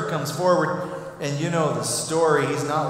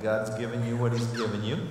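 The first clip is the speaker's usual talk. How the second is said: usual speaking voice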